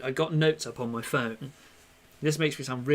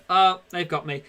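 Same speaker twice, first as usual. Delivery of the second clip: imitating the burglar; 4 semitones higher, 7 dB louder than usual. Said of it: "they're gonna go".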